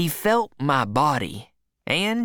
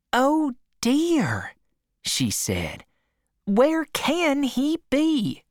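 higher, slow put-on fret; mock-worried aunt; 9 semitones higher, slower than usual. A narrator's reading. Aunt faking worry to fool her husband, higher and slow. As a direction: pretend to be worried.

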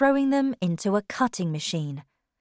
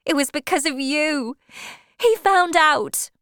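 high, raised woman's cry; distressed accusation; 9 semitones higher, 5 dB louder than usual. Acted. Wife blaming her seducer, distressed. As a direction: cry it out high, slowing on the reveal. Tess blames Alec, after "she cried.

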